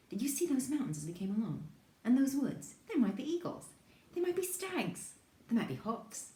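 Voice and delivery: put-on high, eager child's voice; excited. Child imagines wildlife around the new house, excited. Boy imagines animals to explore.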